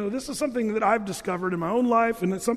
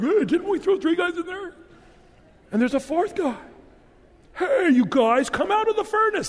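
much higher, louder, jittery Don Knotts king; high panicky king calling into the furnace; 10 semitones higher, 5 dB louder than usual. Retold or imitated. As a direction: squeak it out like a startled Don Knotts. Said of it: "That's who I'd make, Nebuchadnezzar".

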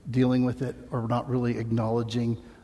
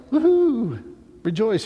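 high, loud mock cheer "Woohoo"; touchdown cheer; 8 semitones higher, 5 dB louder than usual. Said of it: "like after a touchdown".

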